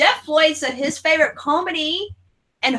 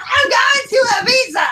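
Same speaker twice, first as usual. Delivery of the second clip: put-on high, loud drunken shout; 10 semitones higher, 4 dB louder than usual. Drunk at a bar telling friends, shouting. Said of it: "I was like".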